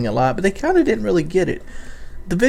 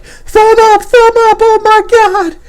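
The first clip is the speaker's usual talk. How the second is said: very high, loud mock fan squeal; 9 dB louder, slower than usual. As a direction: shriek like a giddy fanboy. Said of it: "And the people are like".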